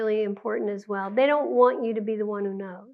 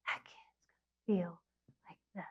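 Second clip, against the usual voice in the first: soft hushed whisper; 4 semitones lower, 14 dB quieter than usual. Real, not acted. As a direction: drop to a whisper to show the feeling. Teacher shows the kid's feeling.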